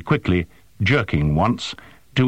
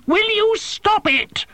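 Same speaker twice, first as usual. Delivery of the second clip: very high, drawn-out screech of outrage; exasperated high-pitched screeching machine; slower than usual. A narrator's reading. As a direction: screech it, stretching every word. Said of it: "screeched the drone".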